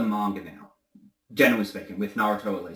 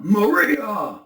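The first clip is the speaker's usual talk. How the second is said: high, loud anguished cry; Shadow crying out; 11 semitones higher, 7 dB louder than usual. Shown as a demonstration. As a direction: call out the name in loud grief. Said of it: "but it'll be more like".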